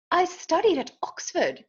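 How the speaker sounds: high-pitched British scholar duck; British accent. A narrator's reading. Duck claims an Oxford education, British accent. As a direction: answer in a put-on British accent. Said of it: "How is your accent so perfect".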